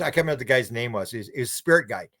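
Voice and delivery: normal talking voice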